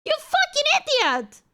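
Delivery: high-pitched, indignant outburst; heated, high-pitched scolding; real exasperated accusation. Real, not acted. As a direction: call them out, voice climbing in outrage. Accusing someone of skipping the clip.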